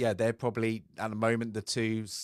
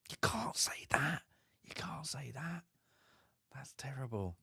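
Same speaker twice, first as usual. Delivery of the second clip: whisper; 2 semitones higher, 9 dB quieter than usual. Real, not acted. Scandalised aside at a rival club.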